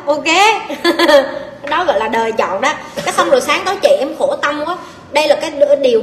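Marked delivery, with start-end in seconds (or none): 0.3-1.6 short amused chuckle; laugh. Real, not acted. Coach amused after warning how life punishes, chuckling. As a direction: chuckle at your own joke.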